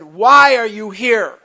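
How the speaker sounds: loud, slow pressing question; retold loud demand. Sponsor pressing him.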